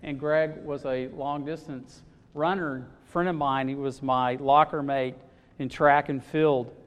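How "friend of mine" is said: ordinary talk, nothing marked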